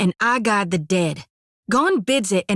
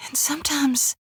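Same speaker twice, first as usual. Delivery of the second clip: soft whisper; 5 semitones higher, 4 dB quieter than usual. Acted. Companion confides about darkness.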